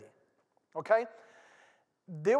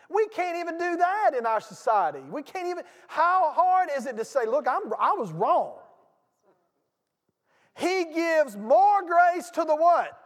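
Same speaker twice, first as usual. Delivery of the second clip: much higher, louder, slowing at the end; full-force preaching; 9 semitones higher, 7 dB louder than usual. Acted. Pastor challenging the congregation, full-force preaching. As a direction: build and hammer the question home.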